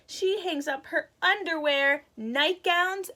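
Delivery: ordinary delivery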